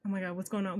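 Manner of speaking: normal talking voice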